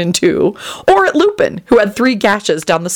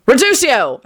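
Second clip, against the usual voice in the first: high-pitched yelled incantation; screamed spell; 6 semitones higher than usual. A narrator's reading. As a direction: cry out the spell, pitch jumping up. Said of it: "Kingsley screamed".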